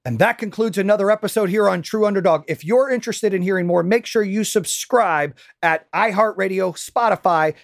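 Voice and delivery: high, upbeat show outro; announcer outro. Acted. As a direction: sign off like a host.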